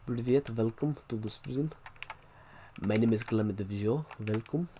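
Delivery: put-on Russian-accented English; mock Russian accent demo. Shown as a demonstration. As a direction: greet the stream in a Russian accent. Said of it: "I can speak a bit of Russian".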